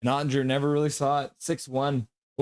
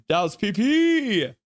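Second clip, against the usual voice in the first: higher drawn-out call, last letter held long; excited call; 6 semitones higher, 4 dB louder, slower than usual. Real, not acted. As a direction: stretch the final P into a rising cheer. Calling out the power play.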